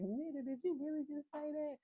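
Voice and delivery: high-pitched incredulous question; imitated disbelief. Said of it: "if y'all could see how Sierra eyes".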